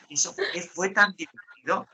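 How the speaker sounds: ordinary talk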